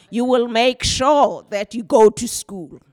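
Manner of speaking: mother's firm slow command; firm instruction to daughter. Retold or imitated. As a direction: tell her she will go to school, no arguing. Mother instructing her daughter firmly.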